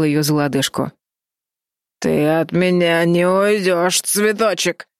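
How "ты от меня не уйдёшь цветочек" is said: slow slurred menace; drunken slur. A narrator's reading. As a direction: slur it like a drunk threatening her. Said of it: "заплетающимся языком проговорил он".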